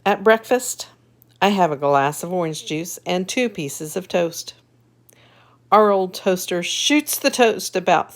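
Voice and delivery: lively storybook reading; reading to children. A narrator's reading. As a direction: read it brightly to little kids. Reads picture book aloud.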